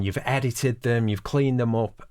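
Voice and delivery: normal talking voice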